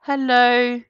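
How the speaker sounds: ordinary talk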